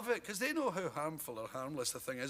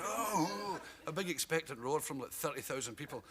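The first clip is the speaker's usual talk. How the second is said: ordinary delivery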